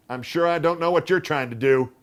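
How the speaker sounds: huffy and put out; irritable aunt. A narrator's reading. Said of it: "said Aunt Wilma irritably".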